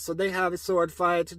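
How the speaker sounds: ordinary talk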